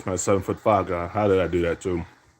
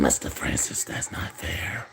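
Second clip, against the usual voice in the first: breathy unvoiced whisper, much softer; taunting whisper; 5 semitones lower, 8 dB quieter than usual. Gamer whispering a taunt at Mister Francis, hushed. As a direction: whisper the taunt close to the mic. Taunting opponent after a play.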